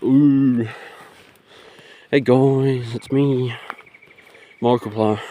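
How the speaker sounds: lightly voiced YouTube greeting, imitating; a friend at the dance clowning as Markiplier, lightly voiced; Markiplier intro. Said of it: "and be like".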